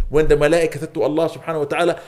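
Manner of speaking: ordinary talk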